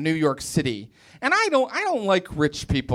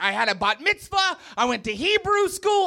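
mock indignant protest, rising high; lightly acted outrage, climbing in pitch; imitated angry girlfriend; 9 semitones higher than usual. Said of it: "She got very angry with me".

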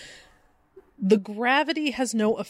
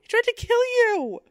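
even higher squeaky echo; high-pitched. Pretend. Repeats partner's quote higher.

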